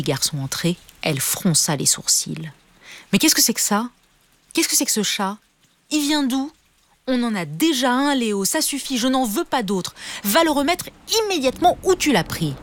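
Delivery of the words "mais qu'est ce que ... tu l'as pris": high, sharp scolding mother's voice; a cross mother telling off her son; angry mother. A narrator's reading. Mother scolds Léo about the stray cat, high and angry. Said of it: "La maman de Léo était vraiment très en colère".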